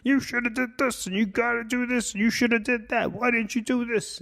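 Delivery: high-pitched nagging authority voice; scolding parent. Acted. Inner critic judging you for what you missed, scolding parent. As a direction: nag like a disapproving teacher. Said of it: "It sounds like your parents, your teachers".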